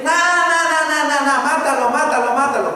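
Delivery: drown out reason like a stubborn crowd; mocking mob. Mocking the mob not listening.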